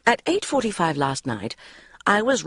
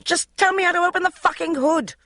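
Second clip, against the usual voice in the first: yelling; 13 semitones higher than usual. Acted. Magda yelling down the phone at husband, desperate. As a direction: demand it at full volume. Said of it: "Magda was yelling".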